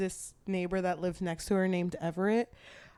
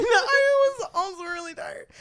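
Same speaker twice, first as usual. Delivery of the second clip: laughing admission; 10 semitones higher, 5 dB louder than usual. Real, not acted. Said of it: "You were also tired".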